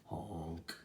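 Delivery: usual speaking voice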